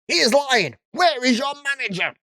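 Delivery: hammed-up angry customer; mock yell. Karen insisting to staff, mock shouting. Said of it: "I can't keep this voice up".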